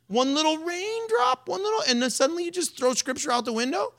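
high, teasing challenge to timid man; mocking Jesus. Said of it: "mocking Jesus challenges".

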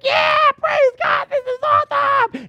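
overjoyed yell of praise; ecstatic. Retold or imitated. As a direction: shout it out like the best news ever. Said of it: "And I was like".

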